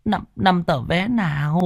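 high, frightened stammer; old mother scared of her son; trembling. A narrator's reading. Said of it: "run run nhìn con". (run rẩy sợ hãi)

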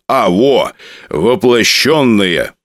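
slow triumphant announcement. Acted. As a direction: proudly declare the word. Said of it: "Торжественно заявил он".

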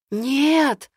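scared drawn-out "no"; frightened. Acted. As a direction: cry out in sudden fear. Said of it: "протягиваю испуганно".

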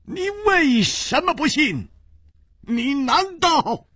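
high, rasping urgent question; hoarse cry. A narrator's reading. Chu Liuxiang grabs his collar and rasps at him. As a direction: press him hard, voice torn. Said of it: "嘶声说".

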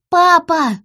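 high, loud call for father; child's distant cry. A narrator's reading. Daughter calling her lost father in the storm, high shout. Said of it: "голос Ири был едва различим".

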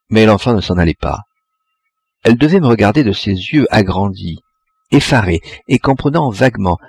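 usual speaking voice

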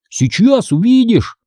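high, drawn-out teasing promise; mysterious. A narrator's reading. Brother about to show his sister a book, mysterious. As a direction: stretch it out to keep the secret. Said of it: "таинственно сказал он".

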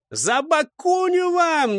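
high, drawn-out war cry; distant battle cry. A narrator's reading. Attackers on the riverbanks yell at the squad, battle cry. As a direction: holler it across to the enemy. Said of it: "неслось с берегов".

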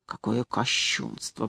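slow, low whisper. A narrator's reading. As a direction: drop to a hushed, drawn-out whisper. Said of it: "прошептал он".

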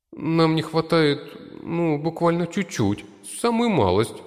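very high coy sidekick voice; high servant voice. Acted. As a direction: coax it out sweetly. Red servant asks.

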